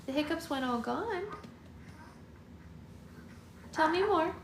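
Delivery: high, soft cooing. Real, not acted. Mother cooing to her baby girl, high and sweet.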